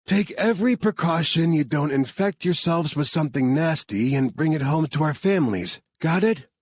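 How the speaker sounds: lightly voiced team leader briefing; Laney voice. A narrator's reading. Laney briefing the team before the breach, lightly voiced.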